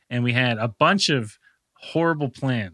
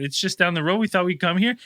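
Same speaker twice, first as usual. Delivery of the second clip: ordinary delivery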